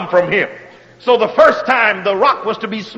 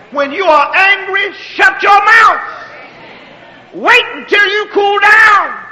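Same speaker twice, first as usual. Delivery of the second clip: high preaching shout, drawn out; forceful preaching; 8 semitones higher than usual. Real, not acted. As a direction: drive home shut your mouth. Preacher warning to shut up.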